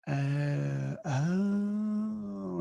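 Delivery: long drawn-out 'oh' of dawning recognition; sudden sweet realization. Retold or imitated. Nurse learns the visitor is a colleague, suddenly sweet realization. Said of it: "instant change, instant sweet, lovely".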